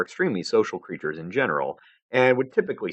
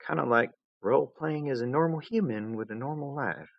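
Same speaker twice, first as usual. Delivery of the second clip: softer, wistful recollection; wistful echo; 7 semitones higher, 4 dB quieter than usual. A narrator's reading. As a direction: recall it with a hint of sadness. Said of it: "She'd sounded sad, almost".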